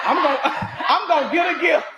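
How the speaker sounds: high laughing declaration; giggling through the words. Real, not acted. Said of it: "you were feeling some kind of way".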